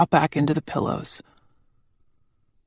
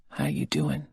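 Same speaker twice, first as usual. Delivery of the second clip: low, hushed, much softer question; soft whisper; 14 semitones lower, 12 dB quieter than usual. A narrator's reading. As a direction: whisper it gently to her. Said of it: "he whispers softly".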